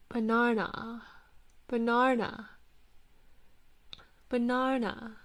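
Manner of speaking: banana as "buh-NAR-nuh" in British accent. Shown as a demonstration. Streamer asked to say banana, British accent. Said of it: "pronounce banana the British way".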